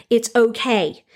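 ordinary talk

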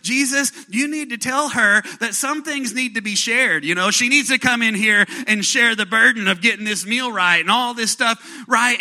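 high, loud exasperated complaint; frustrated Martha. Retold or imitated. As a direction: plead with Jesus, fed up and loud. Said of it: "she's frustrated".

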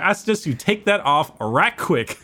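Louisiana grandma. Retold or imitated. Tipsy grandmother ordering a hat off, Louisiana accent. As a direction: lay down the law as a Louisiana grandmother. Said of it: "sound like a good old Louisiana grandma".

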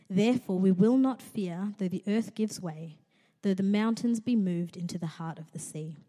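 solemn psalm reading; scripture reading. Acted. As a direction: read it out to the congregation. Reads Psalm aloud.

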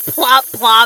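mock plop noises; plop sound. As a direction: say it like drips falling. Imitating toilet sounds for ASMR joke.

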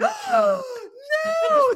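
gasp, then wail in pretend dread; mock horror. Telling her irrational fear of politics, mock horror. Acts out her horrified reaction.

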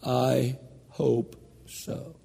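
"I hope so." said faint, slow and barely voiced; very quiet. Dying aunt answering the preacher, very quietly. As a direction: whisper "I hope so." weakly, hardly able to talk. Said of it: "very quietly said".

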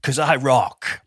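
boastful show-off. Retold or imitated. Mocking a bragger who walks in and demands things, cocky swagger. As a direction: puff yourself up like a bragging hero. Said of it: "they say things like".

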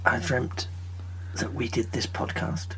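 hushed dreamy whisper; hazy puzzled dream recounting. Acted. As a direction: whisper it like half-awake from a dream. Dreamy whispered skit opening.

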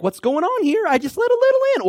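alarmed surprise. Acted. Sinner who let a little in, startled squeak. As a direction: panic as it gets out of hand.

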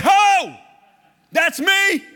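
high, loud cry of recognition; excited outburst. Retold or imitated. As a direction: shout it like you just found yourself in the text. Recognizing himself in the verse.

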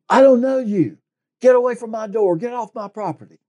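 high, loud, hostile yell at the door; shouting at trespasser. Retold or imitated. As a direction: chase a stranger off your porch. Woman yells through closed door.

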